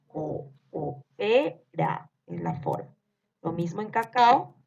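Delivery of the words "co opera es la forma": "Co-, opera" drawn out slowly, a break after "Co"; slow teacher split of "Co-, opera"; slow syllable split. Shown as a demonstration. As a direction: spell "Co-, opera" out slowly and lower. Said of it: "van separadas en diferentes sílabas".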